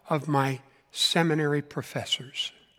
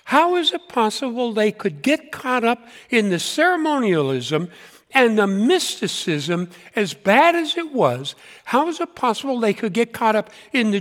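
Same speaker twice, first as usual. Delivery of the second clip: incredulous disbelief; 6 semitones higher than usual. Retold or imitated. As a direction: ask it as if you can't believe it. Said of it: "so you say to yourself".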